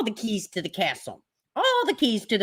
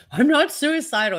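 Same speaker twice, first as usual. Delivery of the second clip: mocking high whine, a touch higher; mocking Smollett; 8 semitones higher than usual. Streamer mocking Smollett's jail claim, in a high mocking voice. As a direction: mimic him whining his denial. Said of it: "He said it, like, twelve times".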